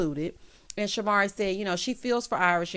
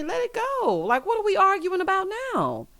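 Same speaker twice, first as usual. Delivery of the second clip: exasperated; 11 semitones higher than usual. Real, not acted. Exasperated at the ladies.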